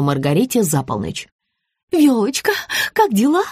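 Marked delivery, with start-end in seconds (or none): 1.9-3.4 higher-pitched syrupy greeting; sugary sweet. A narrator's reading. Rita answers a midnight call, feigning sweetness. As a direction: put on a honeyed, fake-friendly voice. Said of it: "прикинулась сладкой мармеладкой дама".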